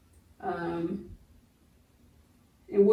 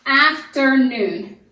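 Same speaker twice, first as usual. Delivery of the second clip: slow, loud "Afternoon", each syllable drawn out; syllables pulled apart; 2 semitones higher, 5 dB louder, much slower than usual. Shown as a demonstration. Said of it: "put our syllable dividers".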